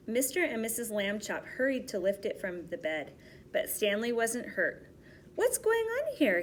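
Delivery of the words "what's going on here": put-on bright high child voice, upbeat; cheerful. Stanley waking up, cheerful high child voice. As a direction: pipe it up like a cheerful kid. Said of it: "he said cheerfully".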